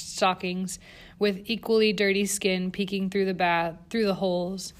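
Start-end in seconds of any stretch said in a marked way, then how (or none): none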